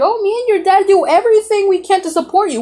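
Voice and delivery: high-pitched mock-tearful mother voice; imitated crying mom. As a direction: wail it pleadingly like an upset mom. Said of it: "my mom starts crying because she's like".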